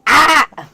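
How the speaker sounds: high, loud sudden "Ah!" scream; imitating a dog's loud scream; mock scream. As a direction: let out a short startled yelp. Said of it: "He just goes".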